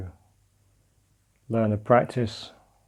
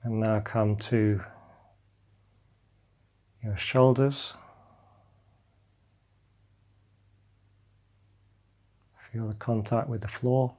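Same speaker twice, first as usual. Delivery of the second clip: calm meditation guidance, drifting softer; guided meditation; 3 dB quieter than usual. Acted. Teacher guiding listeners lying on the floor through a body scan, calm meditation voice. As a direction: guide them gently into letting go.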